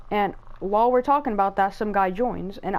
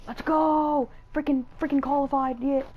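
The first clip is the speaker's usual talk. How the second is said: excited cheer; 6 semitones higher than usual. Real, not acted. Qualifies in Fall Guys.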